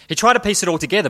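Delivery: quick anxious storytelling; urgent narration. Acted. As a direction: rush the line as panic builds. Hurried narration inside the story.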